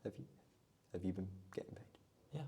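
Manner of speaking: hushed mock-secret question; whisper. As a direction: whisper it as if nobody should hear. Conspiratorial joke about secret pay.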